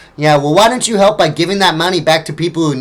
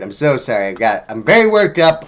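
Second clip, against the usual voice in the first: usual speaking voice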